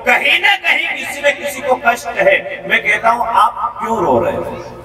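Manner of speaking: high, impassioned preaching questions to the crowd; fervent preaching. Real, not acted. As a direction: preach it out, pressing each question. Preaching at full force. (जोशीले अंदाज़ में प्रवचन)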